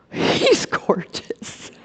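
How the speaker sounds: breathless, dreamy soft whisper; swooning whisper. Retold or imitated. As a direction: sigh it out as if melting at the sight of him. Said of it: "and I swoon".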